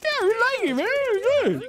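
squeaky high voice. Acted. Joins in with the high voice.

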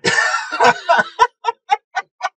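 loud, high-pitched laughing fit; real laughing fit. Real, not acted. Host cracking up at the missed flight excuse, real laughter.

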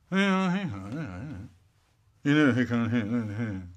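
inarticulate noncommittal noises; brother's mumbled reply. Acted. Brother fobbing off dad's question, vague mumble. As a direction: mumble an I-don't-know, sinking away. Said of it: "my brother goes".

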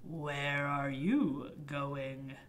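low, slow muttering wolf. Acted. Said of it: "A lurking wolf muttered".

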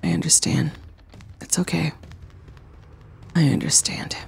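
soft whispered reassurance. Real, not acted. Player waking with two dudes, whispered. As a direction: whisper it gently.

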